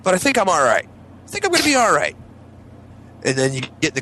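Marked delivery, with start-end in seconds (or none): 0.0-2.1 high-pitched self-reassurance; uneasy inner voice. Retold or imitated. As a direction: talk yourself out of needing the toilet. Voicing worried thoughts before car ride.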